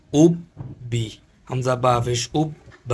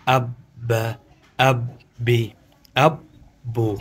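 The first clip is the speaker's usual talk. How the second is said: ordinary delivery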